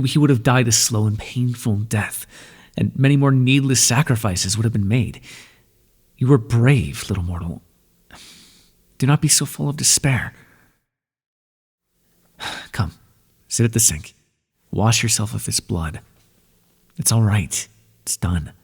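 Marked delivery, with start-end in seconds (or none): none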